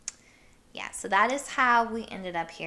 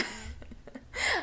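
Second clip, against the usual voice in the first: airy giggle, pitched way up; high breathy giggle; 6 dB quieter than usual. Real, not acted. Vlogger amused by her Alabama ID joke, high giggle. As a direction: let a high giggle slip out.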